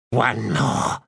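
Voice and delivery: ordinary delivery, nothing marked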